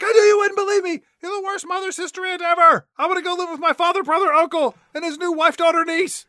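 high, loud, rapid hammed-up outburst; mock tantrum. Crystal lashing out at her mother-sister-aunt, mock furious. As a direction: rant shrilly like an aggrieved child.

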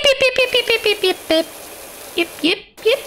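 very high rapid yips; imitated bat song. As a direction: squeak out tiny bat yips. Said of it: "That is the song of bats".

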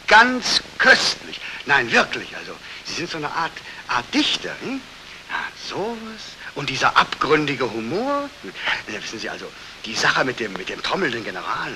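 ordinary delivery

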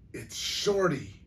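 high, drawn-out alarmed stage hiss. A narrator's reading. Grandmother warns aunt about the threat, alarmed hiss. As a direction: hiss it high and urgent, stretching it out. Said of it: "Graham hissed to Aunt Gladys".

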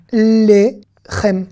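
"Lechem" slowly as lé, then chem; syllable by syllable. Shown as a demonstration. Teacher decoding the Hebrew word for bread, syllable by syllable. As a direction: separate both syllables of "Lechem". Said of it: "on va faire consonne plus voyelle".

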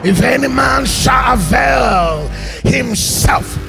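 usual speaking voice

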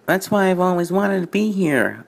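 high feminine engine voice. Acted. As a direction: play the new engine as a lady. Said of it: "in a feminine voice".

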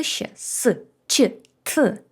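ordinary talk, nothing marked

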